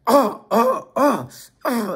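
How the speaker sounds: high, repeated yelps; imitated pained cries. Imitating Termite being stabbed in the cell, pained cries. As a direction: cry out as each blow lands. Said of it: "they actually heard Termite screaming".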